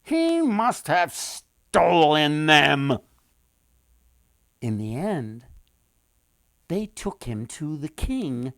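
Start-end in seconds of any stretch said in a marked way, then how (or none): 0.0-3.0 accusing townsfolk. A narrator's reading.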